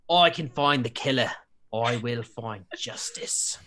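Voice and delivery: Cockney aimed for, drifting into Australian; attempted Cockney accent. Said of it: "trying to make it more Cockney than Au-, than Australian".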